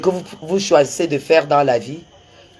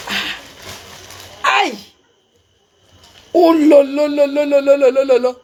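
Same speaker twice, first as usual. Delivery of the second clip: excited; 8 semitones higher, 3 dB louder, slower than usual. Real, not acted. Overwhelmed on receiving wig gift.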